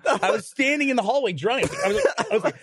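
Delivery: real laughter as he tells it; laughing. Real, not acted. Guys at the table hear him laugh through the story.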